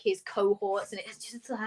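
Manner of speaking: normal talking voice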